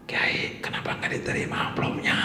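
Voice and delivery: whispered question with a giggle; a student whispering to the teacher, laughing. Retold or imitated. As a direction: ask it under your breath, half laughing. Student asks, whispered through laughter.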